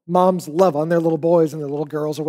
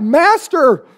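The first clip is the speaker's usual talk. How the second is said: raised, higher mock cry for help; 8 semitones higher, 5 dB louder than usual. Disciples shaking Jesus awake in the storm, crying out. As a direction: call out as if waking someone in a panic. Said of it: "and cried out".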